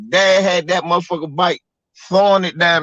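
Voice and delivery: ordinary talk, nothing marked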